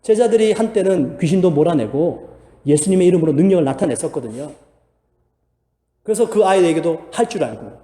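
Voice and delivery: ordinary talk, nothing marked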